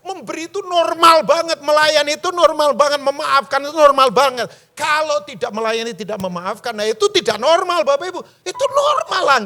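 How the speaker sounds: loud, high preaching, hammering each line; forceful preaching. Real, not acted. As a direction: preach it to the back row.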